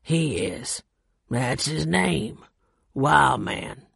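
lightly voiced gruff mountain man; low-voiced Carl. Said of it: "Carl reached down".

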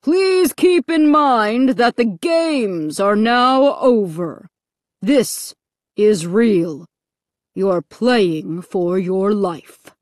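very slow, menacing announcer; frightening voice. A narrator's reading. Loudspeaker voice warning victims the games are over, menacing. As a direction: spell out the threat slowly and ominously. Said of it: "frightening voice over a loudspeaker".

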